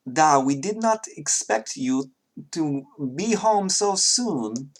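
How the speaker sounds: a hint of a Russian girl's voice; female Russian accent. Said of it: "a Russian accent".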